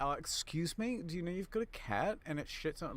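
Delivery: high, overly polite neighbor voice; polite complaint to neighbor. Retold or imitated. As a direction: put on a prim polite voice. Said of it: "be like".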